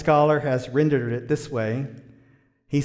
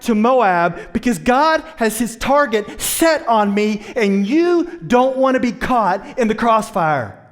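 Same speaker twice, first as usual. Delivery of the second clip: loud, high urgent warning as Naomi; voicing Naomi; 8 semitones higher, 8 dB louder than usual. Preacher as Naomi urging the ladies to go back, loud and high. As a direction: Naomi pleading with her daughters-in-law to flee.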